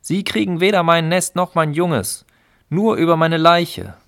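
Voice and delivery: mother bird defending her nest against officials; determined. Acted. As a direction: stand your ground, fierce. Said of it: "sagte entschlossen".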